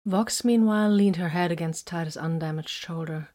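plain reading voice

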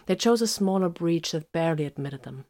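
plain reading voice

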